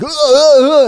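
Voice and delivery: high, loud mock screaming; hammed-up partygoer screams. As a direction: yelp like partygoers panicking.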